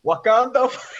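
loud, high mock battle cry; echoed catchphrase. Echoing co-host's accent joke, high shout. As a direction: call it out high, then break off.

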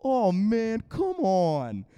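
high, loud mock complaint; imitated frustration. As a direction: protest like a thwarted kid. Retold kid's cups fall again.

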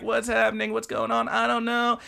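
put-on high, dazed girl's voice; a bewildered high squeak; high confused schoolgirl; confused anime girl. Karen half-asleep, confused.

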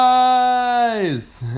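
high, loud, held-out cheer; surprise-party shout. Acted. Sharks springing a party, loud high cheer. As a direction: burst out with it, stretching the end.

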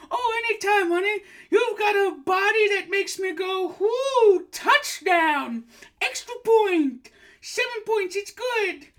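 very high, loud, gushing granny voice; a shrieking delighted old woman; high old lady voice. Acted. Host in falsetto as psychic, gushing shrill greeting. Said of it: "doing the voice".

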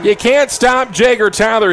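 slow shouted excited call; touchdown call. Real, not acted. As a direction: shout it as he scores. Touchdown run called live.

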